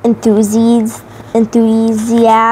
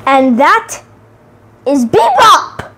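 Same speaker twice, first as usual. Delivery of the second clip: dramatic reveal; 5 semitones higher than usual. A narrator's reading. Narrator unveils Bebop to listeners, booming reveal. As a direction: announce the villain like a showman.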